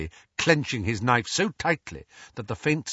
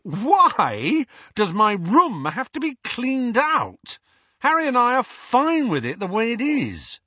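put-on teen son arguing with his mother over cleaning; indignant teen; 14 semitones higher, 6 dB louder than usual. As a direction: whine it as an unfair demand.

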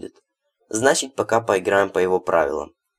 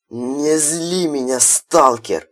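rasped slow warning; hoarse; 7 semitones higher, 3 dB louder, much slower than usual. A narrator's reading. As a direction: wheeze it out hoarsely. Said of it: "прохрипел разорённый барыга".

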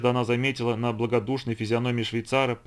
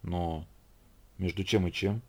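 low, soft, hesitant question; halting, helpless murmur; faltering babble; 4 semitones lower, 6 dB quieter, slower than usual. A narrator's reading. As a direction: trail into timid bewilderment. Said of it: "пролепетал он".

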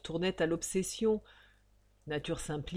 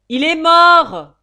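very loud, drawn-out high cry; full-force shouted announcement; shout from afar; 9 semitones higher, 21 dB louder, slower than usual. Acted. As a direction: yell it from the back of the room. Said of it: "cria-t-on du fond".

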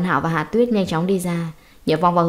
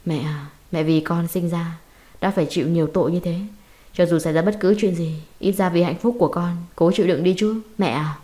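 plain reading voice

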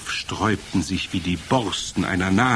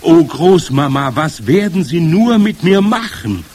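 loud, rising desperate cry; frightened wail; 7 semitones higher, 9 dB louder than usual. Acted. Trapped boy thinks of his grandmother, frightened. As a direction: wail helplessly, pitch climbing.

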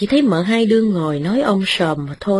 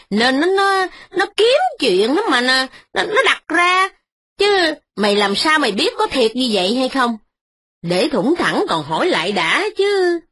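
put-on higher, scolding older woman's voice; frowning old mistress; 6 semitones higher than usual. Mother-in-law answers her son's wife, frowning and higher. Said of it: "Bà cai chau mày mà đáp rằng".

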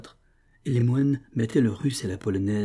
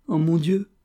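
high, loud cry of distress; anguished cry; 8 semitones higher, 6 dB louder than usual. A narrator's reading. Sobieslava breaks down before the officer, crying out in anguish. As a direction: cry out as if near sobbing. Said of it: "s'écria-t-elle en sanglotant".

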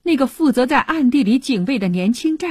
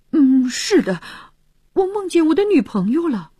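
put-on embarrassed young soldier, higher-pitched; a bashful, higher-voiced admission; sheepish; 8 semitones higher, 3 dB louder than usual. A narrator's reading. Young soldier confessing his dream to Philip, sheepish. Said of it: "不好意思地回答".

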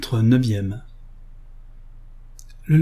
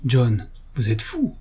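usual speaking voice

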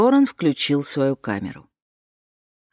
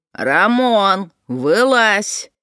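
calling out; 4 semitones higher, 5 dB louder, much slower than usual. Acted. Woman calls into tent again.

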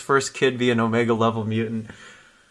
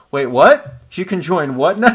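higher-pitched mock disbelief; incredulous reaction; 5 semitones higher, 5 dB louder than usual. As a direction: play up a baffled double take. Polaris reacts to surprise offer.

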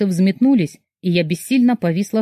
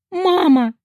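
much higher, breaking cry of mama; Мама! cried out sobbing; 9 semitones higher than usual. A narrator's reading. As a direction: call out through tears. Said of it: "разрыдалась я".

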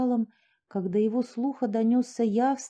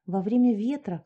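ordinary delivery

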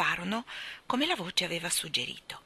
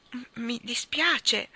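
slow, higher, stammered start; hesitant apology; 5 semitones higher, much slower than usual. A narrator's reading. Jane begins apologising to the fish, hesitant. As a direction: falter into an apology.

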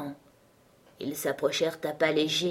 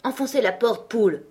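higher, urgent command; urgent acted cry to break in; shouted order; 5 semitones higher, 3 dB louder than usual. A narrator's reading. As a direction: order it with raised voice. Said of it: "s'écria Utterson".

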